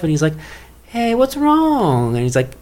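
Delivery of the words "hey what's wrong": high, slow, drawn-out silly Hitler; mock tender Hitler, high and slow. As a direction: coo it like a cartoon buddy. Hitler voiced in retold trailer.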